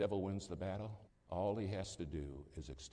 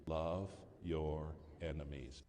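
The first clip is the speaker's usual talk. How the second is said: slow solemn; 2 semitones lower, much slower than usual. Real, not acted. Preacher ending the verse to the congregation, slow and solemn. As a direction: let the command land slowly. Climax of the scripture quote.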